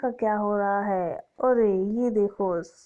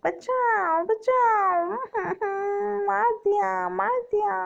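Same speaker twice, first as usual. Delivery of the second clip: much higher crying calls for help; a child shrieking as the witch attacks; screaming child; 11 semitones higher than usual. Acted. Child screams as blood drunk. (बच्चे की ऊँची रोती चीख)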